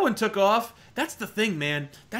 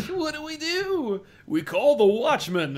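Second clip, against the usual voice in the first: cartoon hero call; 9 semitones higher, slower than usual. Cartoon parody continued.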